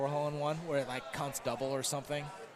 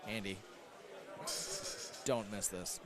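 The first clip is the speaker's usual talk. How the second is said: low, soft inner voice; 7 semitones lower, 11 dB quieter than usual. Retold or imitated. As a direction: talk him through it under his breath. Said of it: "right now in his head, he's like".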